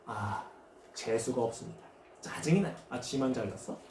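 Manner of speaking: low, soft student griping; muttered grumbling. Acted. Students resenting the class monitor's order, muttered grumbling. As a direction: grumble sullenly under your breath.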